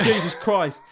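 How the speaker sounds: high loud mock exasperation; exasperated yell. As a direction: blow up at the bird. Continues mock yelling at bird.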